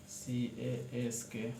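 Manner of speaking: drawn-out spelling of सीएएसके, each letter apart; slow letter-by-letter spelling of सीएएसके; spelling it out slowly. Shown as a demonstration. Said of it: "कास्क।".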